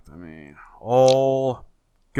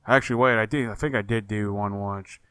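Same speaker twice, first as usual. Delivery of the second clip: normal talking voice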